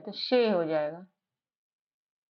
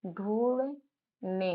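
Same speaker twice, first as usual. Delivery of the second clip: ढूंढने drawn out slowly and softer; slow soft sounding out; 2 semitones lower, 5 dB quieter, much slower than usual. Shown as a demonstration. Teacher softly sounding out ढूंढने for a child. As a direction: ease ढूंढने out gently, syllable by syllable. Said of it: "ये वाला ढू है".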